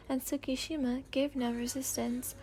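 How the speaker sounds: usual speaking voice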